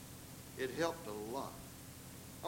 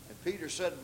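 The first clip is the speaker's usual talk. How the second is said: ordinary talk, nothing marked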